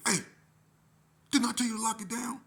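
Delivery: loud, high-pitched reprimand; shouted demand to be obeyed; angry order. Retold or imitated. Staffer with finger in youth's face, angry order.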